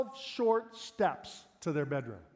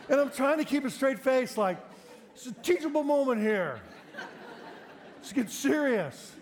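laughing; 6 semitones higher than usual. Real, not acted. Dad failing to stay serious, laughing through it. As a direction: fight off the giggles. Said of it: "But I started laughing".

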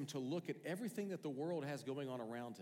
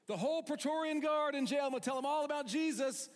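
very high, loud delighted voice; hammed-up joy at being jailed; Paul squealing with glee over the guards; gleeful Paul; 9 dB louder than usual. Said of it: "Paul's like".

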